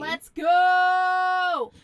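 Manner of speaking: loud hyped cheer; excited shout. Real, not acted. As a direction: shout it out with hype.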